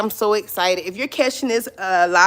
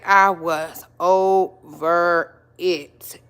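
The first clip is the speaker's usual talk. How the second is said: drawn-out over it; much slower than usual. Real, not acted. Fed up after baking pies.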